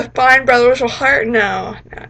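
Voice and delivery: ordinary talk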